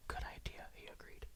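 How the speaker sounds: plain reading voice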